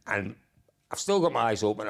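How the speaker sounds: ordinary talk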